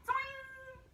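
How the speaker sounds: high boing sound effect; cartoon twang; springy toing. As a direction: make the vine spring back. Liana snaps back.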